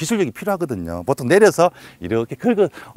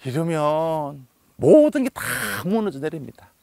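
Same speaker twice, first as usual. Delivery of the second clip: usual speaking voice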